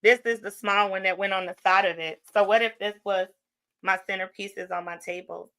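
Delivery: usual speaking voice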